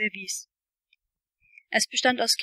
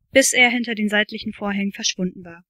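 usual speaking voice

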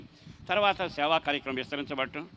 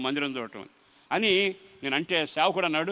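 ordinary talk, nothing marked

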